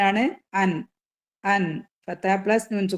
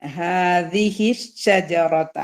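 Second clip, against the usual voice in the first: slow, measured teaching recitation; drawn-out model recitation for pupils; Quran recitation; much slower than usual. Shown as a demonstration. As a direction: recite slowly with long held sounds. Recites the verse being taught.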